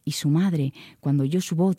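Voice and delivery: ordinary delivery, nothing marked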